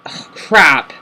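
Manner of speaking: ordinary talk, nothing marked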